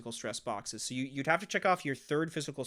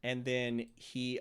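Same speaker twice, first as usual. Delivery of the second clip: ordinary talk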